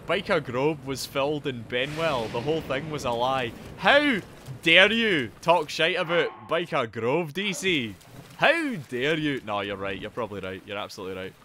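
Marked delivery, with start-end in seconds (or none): 3.8-9.4 loud, drawn-out indignant protest; mock outrage. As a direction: act hugely affronted, stretching the words. Mock outrage at chat's slight.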